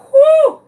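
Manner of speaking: high, loud exhale of relief; relieved whew. Retold or imitated. After finally catching the chicken, loud high whew of relief. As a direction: blow out a big whew.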